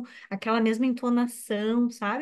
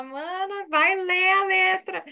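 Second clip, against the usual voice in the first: teasing; 6 semitones higher, slower than usual. Retold or imitated. Teasing her sister.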